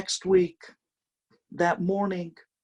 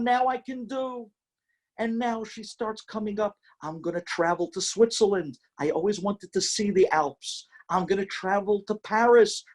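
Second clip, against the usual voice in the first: higher, eager list of dreams; bubbling over with plans; excited wife; 7 semitones higher than usual. Acted. Wife dreams after regaining sight.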